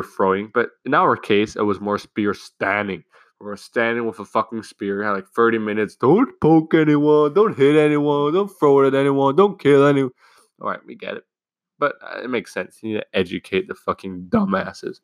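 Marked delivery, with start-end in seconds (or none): 6.0-10.1 nagging teacher. Retold or imitated. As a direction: imitate a teacher droning out safety rules. Teacher's repeated warnings, mocked.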